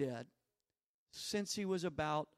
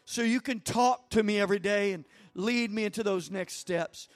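worship prayer; 4 dB louder than usual. Acted. Leads prayer over music.

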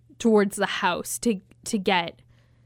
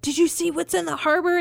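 townsperson; 7 semitones higher than usual. Retold or imitated. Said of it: "somebody's like".

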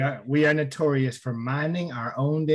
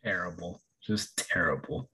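quiet deadpan; 11 semitones lower, 12 dB quieter than usual. Real, not acted. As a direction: drop it low and flat in dismay. Deadpan verdict on the story.

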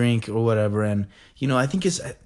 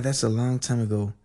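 normal talking voice

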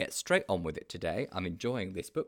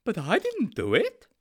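high, louder protest; defensive storybook character, pitched up; Sponge, defensive; 11 semitones higher, 7 dB louder than usual. A narrator's reading. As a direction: protest innocence in a high sidekick voice. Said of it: "said Sponge defensively".

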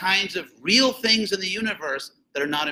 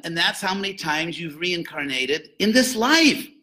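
ordinary talk

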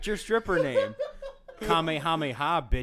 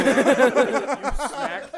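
usual speaking voice

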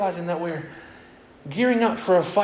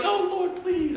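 scared prayer; 11 semitones higher, slower than usual. Retold or imitated. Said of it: "I'm sitting there going".

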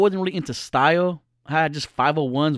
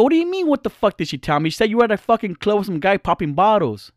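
high, louder incredulous retort; angry fiance calling her out; indignant; 7 semitones higher, 3 dB louder than usual. Retold or imitated. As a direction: fire it back in disbelief. Angry reply to cheating fiancee.

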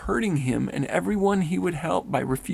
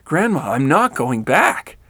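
frantic, much louder plea; 8 dB louder than usual. A narrator's reading. Rennell refusing Grandma, frantic and loud. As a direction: cry out in panic. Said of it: "I became frantic".

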